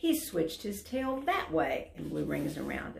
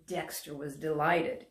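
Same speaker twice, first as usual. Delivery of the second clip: ordinary delivery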